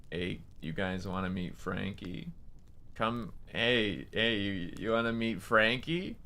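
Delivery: low, quiet, slow gruff stranger. Retold or imitated. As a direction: imitate a creepy man calling softly. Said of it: "in a really gruff voice".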